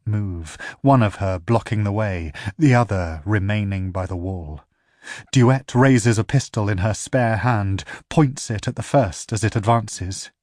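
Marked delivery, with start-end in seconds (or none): none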